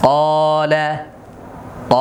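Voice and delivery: কলা with a full, dark, rounded 'aw' vowel held long; thick back vowel, drawn out level; heavy alif. Shown as a demonstration. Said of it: "আলিফটা মোটা হবে".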